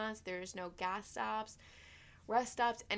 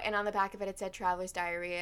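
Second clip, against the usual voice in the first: ordinary talk, nothing marked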